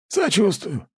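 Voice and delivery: put-on high, wry old grandpa; a smirking old man's voice. A narrator's reading. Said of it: "хмыкнул дедок". (голос дедушки с усмешкой)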